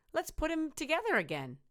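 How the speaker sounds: chirpy helpful little skeleton. Acted. Little skeleton offers to fix the dog, high and chirpy. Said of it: "the little skeleton said".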